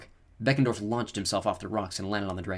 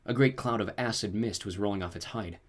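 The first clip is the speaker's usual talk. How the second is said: plain reading voice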